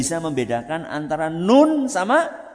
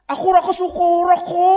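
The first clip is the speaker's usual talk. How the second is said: much higher and louder, an angry parent's yell; harsh scolding shout; 14 semitones higher, 6 dB louder, faster than usual. Shown as a demonstration. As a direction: snap at the child like a furious parent. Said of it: "nek bentak-bentak niku lembut mboten". (bentakan orang tua yang marah)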